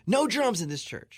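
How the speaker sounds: high, slow, indignant minister decree; mock an outraged minister laying down the law; imitated stern ban. Minister's retold angry ban on drums.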